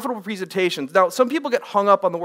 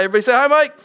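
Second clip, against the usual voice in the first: loud call-out; 7 semitones higher, 7 dB louder, much faster than usual. Real, not acted. Cueing the audience to greet.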